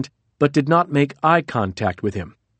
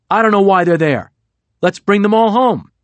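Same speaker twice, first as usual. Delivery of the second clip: heated, louder and higher; really hot; 6 semitones higher, 5 dB louder than usual. A narrator's reading. Trump, fed up with troops abroad, hot and loud. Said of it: "He was really hot".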